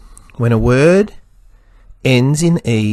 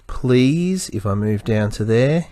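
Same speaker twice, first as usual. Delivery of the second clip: ordinary talk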